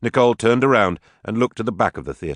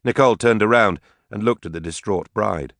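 ordinary delivery, nothing marked

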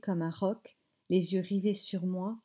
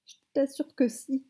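higher-pitched, insistent grandmother; insistent assertion; 6 semitones higher than usual. A narrator's reading. Grandmother contradicting mother's denial, insistent. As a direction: assure her firmly, pitched up. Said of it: "affirma grand-mère".

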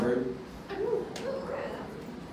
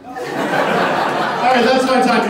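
loud sign-off; 5 semitones higher, 5 dB louder, slower than usual. Real, not acted. Wrapping up the set.

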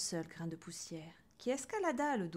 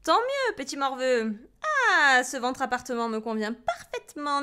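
loud, higher, stretched-out delight; loud self-satisfied witch; gloating witch; 5 semitones higher, 11 dB louder than usual. A narrator's reading. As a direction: crow with satisfaction while stretching. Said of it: "dit-elle en s'étirant".

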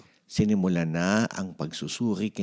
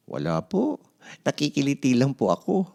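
put-on high boy's voice, grinning; grinning child; 8 semitones higher, 3 dB louder than usual. Mark tells the doctor he is only ticklish, grinning. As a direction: say it with a ticklish grin.

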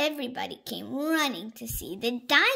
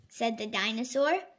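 usual speaking voice

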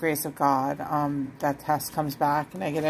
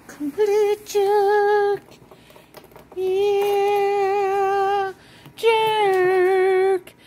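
high, slow, loud singsong; teasing baby talk; 13 semitones higher, 7 dB louder, much slower than usual. Pretend. Teasing her kitten as a complete jerk. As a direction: stretch it out way up high.

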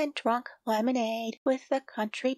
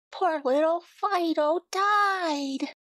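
slow, higher lament; mournful; 6 semitones higher, 4 dB louder, slower than usual. A narrator's reading. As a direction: draw out the sad line. Sad ending of the verse.

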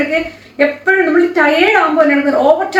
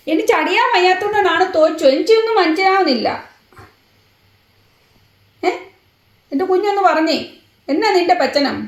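put-on baby-talk pet voice; 5 semitones higher than usual.

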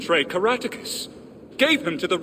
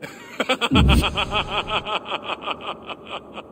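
long, high cackle tapering off in staccato bursts; sinister rolling laugh; ghostly villain laugh; 5 semitones higher than usual. Acted. Ghost laughs at his joke.

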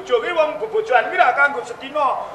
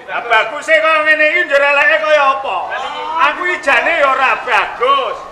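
raised, higher-pitched challenge; loud retort; 5 semitones higher, 5 dB louder than usual. Acted. Regent answering his flattering subject, in a loud retort. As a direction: throw it back loudly at the flatterer.

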